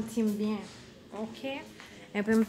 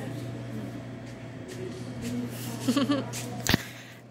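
quiet breathy laugh; 4 dB quieter than usual. Real, not acted.

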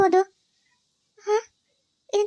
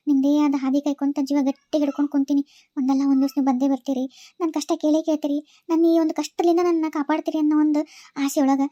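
low-pitched, weary diary voice; suffering wife's diary; 7 semitones lower than usual. Acted. A trapped wife writes to whoever may rescue her, low and pleading. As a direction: read her written plea in a lower voice.

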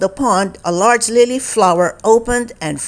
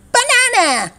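Barry's voice; 14 semitones higher than usual. Acted.

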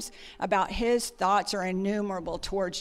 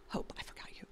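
whispered aside; 17 dB quieter, faster than usual. Real, not acted. Aside to Hope mid-sermon.

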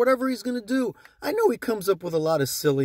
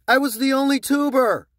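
louder, higher insistence on I; proudly insisting he was first; emphatic claim; 5 semitones higher, 5 dB louder than usual. Real, not acted. Ranting to viewers, loud insistence.

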